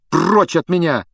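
high, loud, angry rebuff; angry outburst. A narrator's reading. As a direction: blurt it furiously in disgust. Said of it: "гневно выпалил Гарри". (гневный высокий выкрик)

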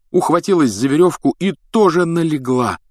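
plain reading voice

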